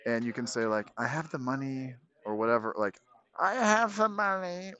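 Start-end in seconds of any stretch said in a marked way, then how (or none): 3.4-4.7 put-on high, slow old granny voice; pitched-up slow grandma impression. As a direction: play a sweet little grandma, drawn out. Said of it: "pretend to be, like, a grandma".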